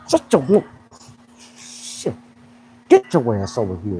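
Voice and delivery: low, stern coach's bark; a hint of a drill coach; gruff coach. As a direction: call him over like a tough coach. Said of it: "if I'm be a coach".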